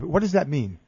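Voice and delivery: usual speaking voice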